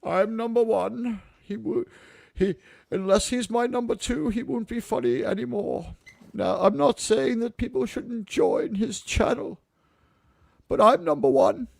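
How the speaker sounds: posh British toff, soft and haughty; snobby British voice. Pretend. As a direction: lord it over them in a snooty British voice. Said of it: "put on my snobby British voice again".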